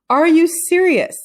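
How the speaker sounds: can't believe what she hears; loud shocked question; incredulous. A narrator's reading. Teacher's disbelief.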